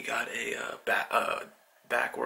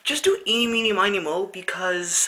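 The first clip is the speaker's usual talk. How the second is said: lightly mocking the teacher's voice, pitched higher; airy, clueless teacher impression; mimicking the teacher; 6 semitones higher, 4 dB louder than usual. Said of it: "she's like".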